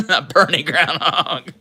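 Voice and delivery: the pun repeated through a laugh; slow, laughing repeat of the pun. Real, not acted. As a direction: say it as you crack up.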